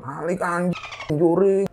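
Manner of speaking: high-pitched, held-back mock yell; retold yell. Rider behind yelling at her while honking, high mock shout. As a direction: imitate a rider yelling, pitched high without full volume. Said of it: "teriak-teriak ke aku sambil ngelakson".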